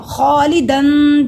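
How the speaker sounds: खालिदन with the final n held in the nose; slow, loud recitation, humming the n of खालिदन; long khaa, then a nasal hold on दन; ghunnah. Shown as a demonstration. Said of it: "दन पे गुन्ना होगा".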